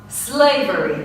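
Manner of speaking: normal talking voice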